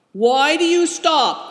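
high, loud, slow call from the dark; loud cry. A narrator's reading. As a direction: shout it from far off. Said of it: "cried a voice out of the darkness".

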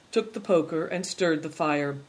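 plain reading voice

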